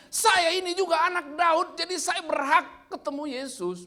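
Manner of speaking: "Saya" punched high and loud, slow; emphatic claim. Retold or imitated. Preacher voicing the blind man claiming his right to meet Jesus, emphatic. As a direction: insist on "Saya ini juga anak Daud". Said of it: "Maksudnya penekanannya".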